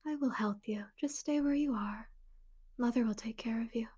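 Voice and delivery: soft whispered motherly promise; Spider Queen voice. Acted. Spider Queen soothing trapped prey, soft whisper. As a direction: breathe it like a spider luring prey. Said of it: "this is my Spider Queen voice".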